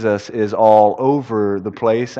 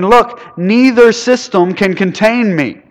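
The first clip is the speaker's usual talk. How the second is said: voicing Jesus; 8 semitones higher, 4 dB louder, slower than usual. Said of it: "Jesus responds to her very lovingly".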